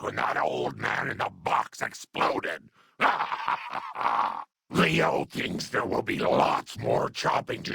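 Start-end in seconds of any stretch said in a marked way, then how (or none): 3.0-4.4 long, low chuckle in character; low laugh. Acted. Grandma recalls Leo laughing.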